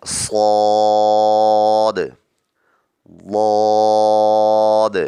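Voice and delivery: صاد loud and held, lips unrounded; heavy sad. Shown as a demonstration. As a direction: keep صاد straight, no w. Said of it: "ہونٹوں کو گول نہیں کرنا".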